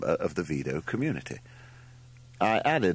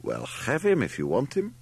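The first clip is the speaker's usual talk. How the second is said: offhand offer, pitched up with a rising end; casual; 7 semitones higher than usual. A narrator's reading. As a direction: toss the offer out casually, higher. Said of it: "said the man casually".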